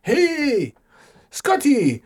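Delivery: high-pitched alien visitor voice; friendly alien. Pretend. Alien invites Scotty aboard for a visit, high alien voice. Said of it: "if one landed in the front lawn and said".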